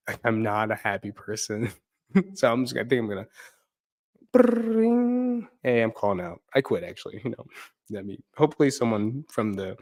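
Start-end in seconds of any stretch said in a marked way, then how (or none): 4.3-5.5 high mock phone ringing sound.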